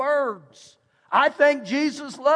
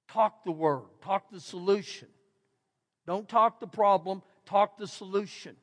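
intense quiet urging; 4 semitones lower, 7 dB quieter than usual. Real, not acted.

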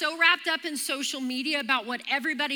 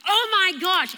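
higher, louder shocked outburst; startled; 5 semitones higher, 6 dB louder than usual. Retold or imitated. Runner reacts to a bird diving at her, startled. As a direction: blurt it out in alarm.

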